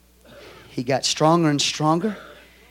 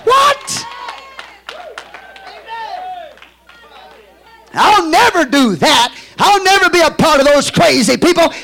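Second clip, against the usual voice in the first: a much higher, louder scandalized outburst; shocked young Lutheran; 12 semitones higher, 8 dB louder than usual. Retold or imitated. Pastor imitating his younger formal Lutheran self, scandalized. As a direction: recoil as if appalled. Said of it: "I go".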